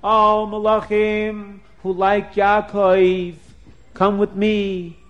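high, loud, slow rallying call; a drawn-out shout calling the angels to gather; screaming call. Acted. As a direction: summon every angel at full voice. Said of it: "they started screaming".

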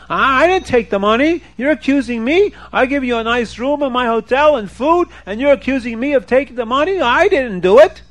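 high, acted outburst of protest; storyteller's mock-screaming hotel man; indignant screaming. A narrator's reading. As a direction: protest the accusation, pitched high. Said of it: "He starts screaming".

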